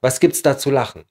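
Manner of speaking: words joined up in one connected phrase. Shown as a demonstration. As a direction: say it as one flowing, shortened phrase. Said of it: "بنحكيها قلنا أسرع".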